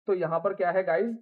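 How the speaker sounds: usual speaking voice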